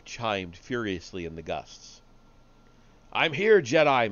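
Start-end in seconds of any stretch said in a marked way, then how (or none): 3.1-4.1 loud, high, booming shout; booming battle challenge. A narrator's reading. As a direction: call out your enemy across the ruins. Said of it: "Malgus shouted, his voice booming".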